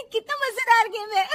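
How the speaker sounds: very high voice between giggles; giggly delight. Acted. Bahu laughing at TV again.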